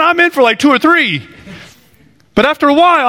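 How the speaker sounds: ordinary talk, nothing marked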